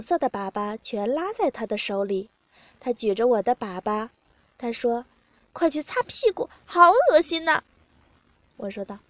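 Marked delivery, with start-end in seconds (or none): 5.5-7.6 higher voice, getting louder in disgust; disgusted complaint. A narrator's reading. Partner sends the narrator away, disgusted complaint. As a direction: complain with revulsion, pitched up.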